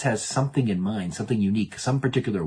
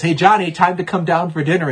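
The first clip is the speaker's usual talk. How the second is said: put-on higher, louder mom summoning her kid; mom calling; 8 semitones higher, 7 dB louder than usual. Mom calls Johnny down for dinner, calling out. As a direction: call out to a child in another room. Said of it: "some mom says".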